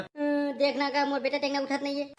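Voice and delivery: high keening mother; acted high-pitched sobbing lament; wailing. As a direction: wail over a son who won't wake. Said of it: "टोटा फाड़ के का रोवत है".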